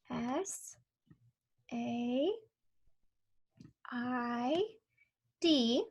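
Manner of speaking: letters spaced out one by one; spelling out letters. Shown as a demonstration. Teacher spelling a sight word while tracing, slow. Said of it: "trace the word said".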